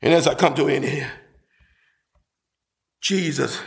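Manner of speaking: low, hushed preacher's voice; measured, low-pitched scripture comfort; comforting sermon close. Real, not acted. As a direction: bring it down low and reassure the church. Preacher closes, quoting a comforting promise.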